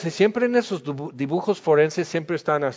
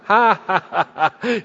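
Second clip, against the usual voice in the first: laugh; 4 semitones higher, 4 dB louder than usual. Real, not acted. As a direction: burst of loud laughter at his own joke. Brother taunt joke, laughing.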